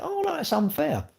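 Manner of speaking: high-pitched mocking whine; mock whiny protest. Mocking a blocked viewer who calls it unfair, in a high whine. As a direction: jump way up into a sulking viewer's whine. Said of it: "You might go".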